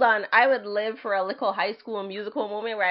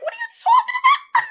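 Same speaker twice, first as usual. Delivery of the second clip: high, loud laughing disbelief; reacting to creepy fans with a shocked shout; incredulous shout; 6 dB louder than usual. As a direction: shriek it in disbelief. Her own incredulous reaction.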